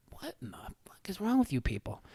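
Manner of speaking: high, hushed disbelief, tumbling out fast; incredulous retold reaction. Dad to screaming parents at the game, incredulous.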